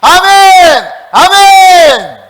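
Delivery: very high, very loud, drawn-out cry; all-out rallying shout; unashamed amen shout. Shown as a demonstration. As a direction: roar it to get the shy crowd joining. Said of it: "부끄러워하지 말고 해보시라니까".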